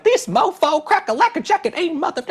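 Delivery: high, exaggerated mocking slang voice; mocking street voice. Podcaster mocking a congresswoman's TikTok voice, high put-on voice. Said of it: "she's like".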